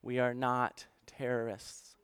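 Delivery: hushed, very slow plea; retold sobbing plea. Refugee pleading to church leaders, retold with subdued sorrow. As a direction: softly imitate a tearful man's halting plea. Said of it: "he just started sobbing".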